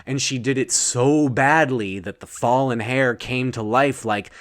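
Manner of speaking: usual speaking voice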